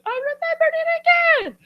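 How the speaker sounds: very high, squeaky put-on voice; imitated high-pitched triumph; squealed film quote. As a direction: squeal it as an excited cartoon character. Quoting a favourite film line.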